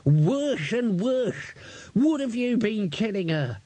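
put-on high, slow, groaning old codger; despairing old man. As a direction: moan it to the ceiling.

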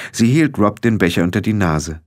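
plain reading voice

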